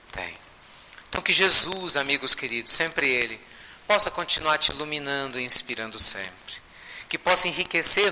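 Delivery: ordinary talk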